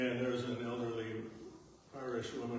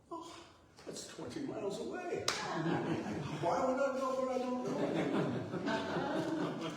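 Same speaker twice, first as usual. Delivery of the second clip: high-pitched mock-incredulous elderly Irishwoman, getting louder; imitated Irish woman; 12 semitones higher, 4 dB louder than usual. Storyteller quoting an elderly island woman to an audience, imitated high incredulous voice. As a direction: imitate an old islander's baffled retort, pitched way up. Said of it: "in her inimitable Irish accent".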